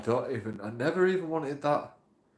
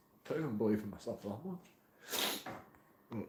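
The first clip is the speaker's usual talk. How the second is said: much softer and slower, deflated; quiet, slow and dejected; 3 semitones lower, 10 dB quieter, slower than usual. Real, not acted. Vlogger admitting his doubts to viewers, dejected murmur. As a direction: let it sink, almost to yourself. Repeats the line twice.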